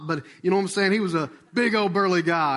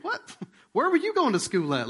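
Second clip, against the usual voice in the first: incredulous; 8 semitones higher than usual. Retold or imitated. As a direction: squeak it out in mock astonishment. Acts out disbelief at people's remark.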